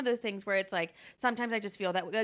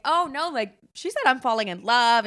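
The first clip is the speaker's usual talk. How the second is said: mock alarm; 2 semitones higher, 7 dB louder than usual. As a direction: blurt it out in playful alarm. Acted protest to Tim.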